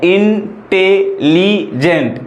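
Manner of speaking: इंटेलिजेंट stretched very slowly, four syllables sounded apart; slow four-beat इंटेलिजेंट; split into syllables. Shown as a demonstration. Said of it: "इंटेलिजेंट चार बार".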